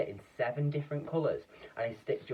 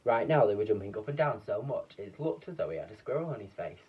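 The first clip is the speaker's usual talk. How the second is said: plain reading voice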